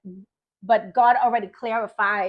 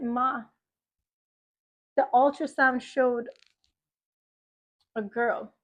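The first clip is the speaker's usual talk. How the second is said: retold tearful confession; 4 dB quieter than usual. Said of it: "and I'm crying".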